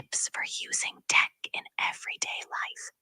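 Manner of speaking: soft unvoiced whisper. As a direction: whisper it like ASMR. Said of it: "Can you whisper the rest of it".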